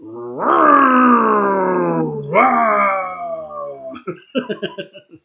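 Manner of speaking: long, loud mock roar tailing into laughter; lion roar. Beer reviewer playing at being a lion, long loud roar. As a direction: let out a big playful lion roar.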